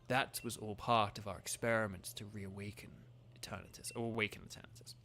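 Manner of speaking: understated menacing Chairman Rose; reading Rose's lines. Acted. Reads Rose's villain lines.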